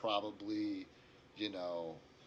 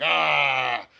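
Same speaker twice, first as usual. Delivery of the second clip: loud, raised "ah"; exasperated orc cry; 5 semitones higher, 15 dB louder than usual. Acted. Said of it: "and the orc's like".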